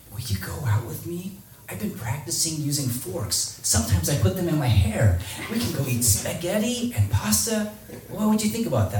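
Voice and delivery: high-pitched mock girl asking someone out; imitated girl. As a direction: act out an eager girl, voice lifted high. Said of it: "What if this girl that you like".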